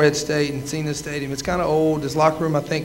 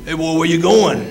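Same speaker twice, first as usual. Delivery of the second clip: Coach Bennett's voice; 4 dB louder than usual. Retold or imitated. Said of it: "If anybody knows Coach Bennett's voice".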